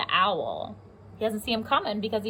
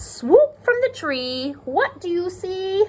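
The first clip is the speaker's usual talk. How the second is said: slow, high storybook reading; reading to children; 10 semitones higher, 5 dB louder, slower than usual. Acted. Teacher reads the owl book aloud to her class. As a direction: read the line slowly for little ones.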